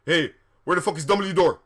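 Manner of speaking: loud, quick, indignant demand; hammed-up angry character; angry shout. A narrator's reading. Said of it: "Draco shooted angrily".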